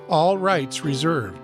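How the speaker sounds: usual speaking voice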